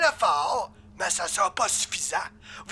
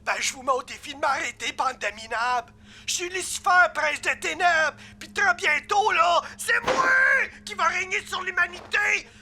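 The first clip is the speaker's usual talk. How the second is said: higher-pitched indignant tirade; angry villain outburst; 4 semitones higher than usual. Acted. Demon challenges his mocking team, angry outburst. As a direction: blow up at the mockers, then boast.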